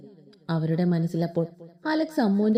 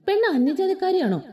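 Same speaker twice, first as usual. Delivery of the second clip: grandmother; 9 semitones higher than usual. Acted.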